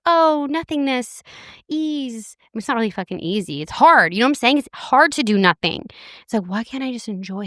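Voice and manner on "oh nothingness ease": higher, slow blissed-out sigh; mock a zen guru floating; dreamy serene. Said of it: "be like".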